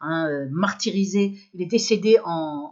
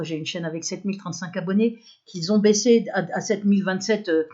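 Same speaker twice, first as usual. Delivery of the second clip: ordinary delivery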